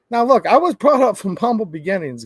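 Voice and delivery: ordinary talk, nothing marked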